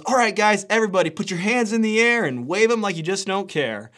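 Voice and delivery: a dad playing hype man on safari; party hype. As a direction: pump up a jeep full of kids. Dad hyping students in jeep.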